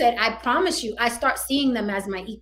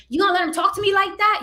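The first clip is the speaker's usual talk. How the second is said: mock outraged woman; 10 semitones higher, 4 dB louder, faster than usual. Mocking a drama-starting woman.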